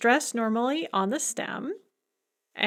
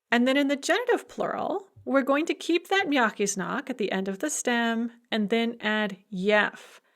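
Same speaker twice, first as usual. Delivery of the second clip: ordinary talk, nothing marked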